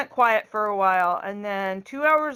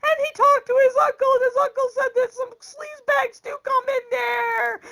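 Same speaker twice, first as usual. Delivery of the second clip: high mock bawling in a rush; exaggerated high wail; imitated crying; 3 dB louder, faster than usual. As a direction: blubber it out like a hammed-up sobbing daughter. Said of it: "And she's crying harder now".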